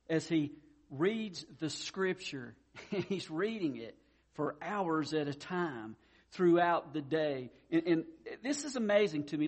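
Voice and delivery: usual speaking voice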